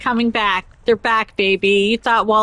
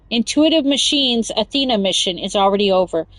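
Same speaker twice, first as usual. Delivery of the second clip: ordinary talk, nothing marked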